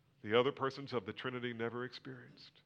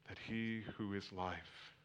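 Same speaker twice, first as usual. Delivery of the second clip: hushed; 4 semitones lower, 10 dB quieter, slower than usual. Acted. Hushed climax again.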